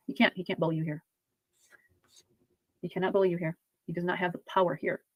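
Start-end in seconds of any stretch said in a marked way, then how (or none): none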